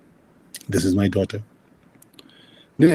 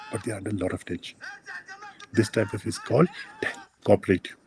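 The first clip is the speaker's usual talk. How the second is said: usual speaking voice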